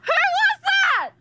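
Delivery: high, loud startled question; alarmed shout. Real, not acted. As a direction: demand to know who spoke, scared. Frightened by unknown voice in call.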